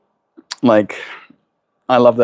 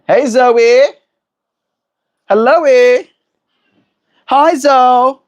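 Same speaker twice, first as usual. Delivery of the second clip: much higher and louder sing-song hello to a bird; pet-greeting voice; 11 semitones higher, 5 dB louder, slower than usual. Real, not acted. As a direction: call out to the bird in a bright pet voice.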